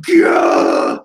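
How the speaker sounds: loud, guttural rampage yell; monster roar. Acted. Monster on a rampage, loud guttural roar. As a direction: roar from the throat. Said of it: "there's more speech bubbles of".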